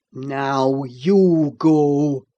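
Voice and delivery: low, loud, very slow command; menacing order. A narrator's reading. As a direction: drag each word out, threatening. Heathcliff orders Earnshaw.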